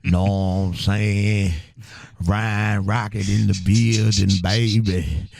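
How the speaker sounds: slow, stretched Houston hip-hop drawl. Shown as a demonstration. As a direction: drag the words out like a syrupy radio-show host. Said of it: "that's a screw impression".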